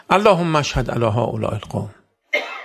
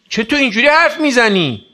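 a parent's raised, higher scolding voice; mock scolding parent; 10 semitones higher, 6 dB louder than usual. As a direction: scold the child in surprise, pitched up. Parent scolding child's new speech.

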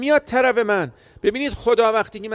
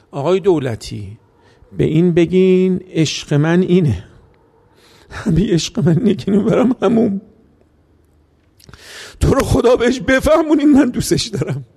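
crying plea; 5 dB louder than usual. Retold or imitated. Weeping father begs the cleric to explain his love, crying. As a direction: plead through tears, rising to a cry. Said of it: "با صدای بلند گریه کرد".

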